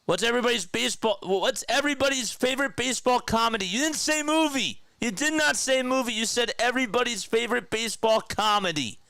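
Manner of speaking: high, insistent yell to prove a point; worked-up, high-pitched correction; real yelling. Real, not acted. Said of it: "why I just yelled".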